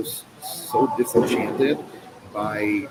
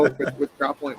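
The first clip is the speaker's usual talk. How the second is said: usual speaking voice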